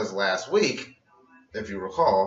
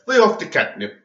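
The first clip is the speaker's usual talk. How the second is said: Bill Cosby impression; 7 semitones higher, 7 dB louder than usual. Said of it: "Like she was Bill Cosby".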